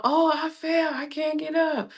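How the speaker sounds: hammed-up mock distress; mock fall cry. Widow imitating her husband faking a fall, mock cry. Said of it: "when he yelled out".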